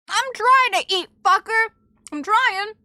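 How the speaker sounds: high, loud whiny complaint; exasperated protest. Real, not acted. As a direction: protest like you are being pestered. Protesting interruption while eating.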